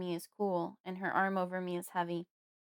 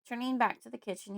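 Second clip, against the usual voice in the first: usual speaking voice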